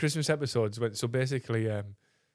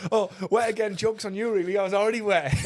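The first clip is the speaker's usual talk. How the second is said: high, louder retort; mock defiant comeback; 8 semitones higher, 5 dB louder than usual. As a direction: imitate a soaked man claiming he won. Retelling comeback after drink thrown.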